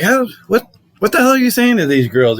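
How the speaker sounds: mock astonishment at the neighbor; incredulous. Narrator amazed, asking the neighbor what he tells girls.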